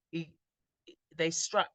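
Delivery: usual speaking voice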